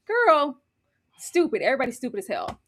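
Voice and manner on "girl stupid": ordinary talk, nothing marked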